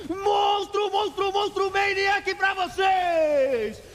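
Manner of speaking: high, roaring hype call; fighting game announcer. Real, not acted. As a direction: belt it out like an arena announcer. Caster hyping a triple kill.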